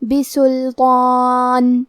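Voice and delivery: slow recited "بسلطان" with heavy, long "طا"; heavy-voiced letters. Shown as a demonstration. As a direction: recite "بسلطان" slowly, ط and alif full and heavy. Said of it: "دونوں کو بھاری آواز".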